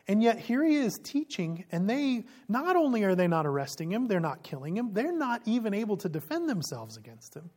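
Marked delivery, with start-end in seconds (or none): none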